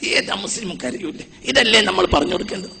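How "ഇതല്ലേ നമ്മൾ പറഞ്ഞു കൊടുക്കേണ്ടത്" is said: normal talking voice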